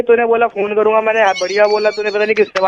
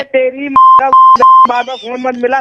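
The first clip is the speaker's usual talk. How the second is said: slow, drawn-out annoyed scolding; fed-up man scolding caller; irritated scolding; 4 semitones higher, slower than usual. Real, not acted. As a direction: tell him off slowly and firmly. Angry at repeated prank calls.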